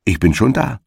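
lightly acted hail; demonstrated call. A narrator's reading. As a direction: show how to call it out. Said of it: "so rufst du ihm entgegen".

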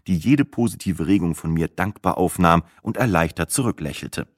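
ordinary delivery

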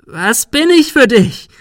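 very high, loud, slow demand; screech. A narrator's reading. Sarah grabbing Ben by the collar, screeching. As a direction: shriek each word in outrage. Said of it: "Sarah kreischte".

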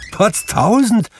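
very high, slow exclamation of surprise; astonished cry. A narrator's reading. Fred startled by a visitor at his door, astonished cry. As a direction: cry out high in amazement, drawing it out. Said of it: "rief Fred".

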